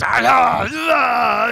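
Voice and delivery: loud high effort cries; loud, high strained cries while chipping at the ice; straining grunts. Retold or imitated. Wife hacking ice with knife.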